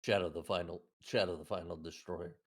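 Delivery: low, hushed ominous title; dramatic villain voice. Pretend. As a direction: intone it like an edgy villain. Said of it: "I don't know a voice for the fucking weird gamer webcomic joke".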